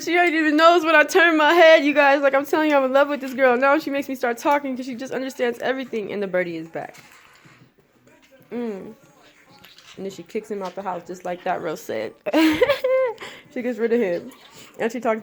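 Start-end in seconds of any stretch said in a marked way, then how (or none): 0.0-6.1 loud, high, excited quote; excited retelling. Retold or imitated. Excitedly voicing what he said. 12.2-13.5 high-pitched laugh. Real, not acted. Laughs after kicking-out remark.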